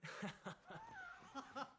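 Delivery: high burst of laughter. Real, not acted. Laughs at host's joke.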